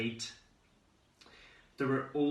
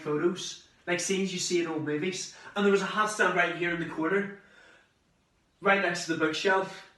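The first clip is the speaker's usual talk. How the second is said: high, louder, thrilled description; delighted; 6 semitones higher, 4 dB louder than usual. Acted. Teenager discovering his own room abroad, excited. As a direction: show off the room with rising excitement.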